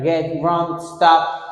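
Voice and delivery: normal talking voice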